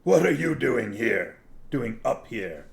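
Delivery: loud, challenging question; harsh-voiced challenge to intruders; harsh demand. A narrator's reading. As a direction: demand an answer like a guard. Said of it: "a harsh voice demanded".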